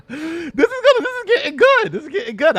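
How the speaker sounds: high, laughing, gleeful; delighted laugh. Real, not acted. Streamer hyped by the interview, laughing. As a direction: cackle with glee.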